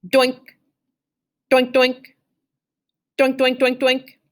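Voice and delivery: bouncy pinging doinks; imitated metal clang. A narrator's reading. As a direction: mimic stones on metal. Imitated rocks hitting metal.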